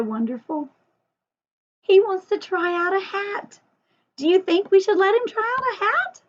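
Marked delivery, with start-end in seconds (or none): 1.8-6.2 excited. Pretend. Talking to kids about the puppet.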